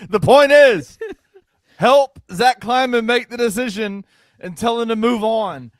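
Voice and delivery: yelling through laughter; loud laughing yell. Real, not acted. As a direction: shout it back, laughing. Yelling back at Santa.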